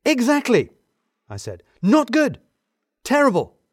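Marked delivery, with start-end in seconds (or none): 0.1-0.7 high, loud exclamation; emphatic agreement. A narrator's reading. 1.8-3.6 very high, loud exclamation; alarmed verdict. A narrator's reading.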